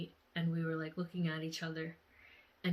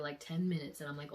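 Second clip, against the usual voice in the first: normal talking voice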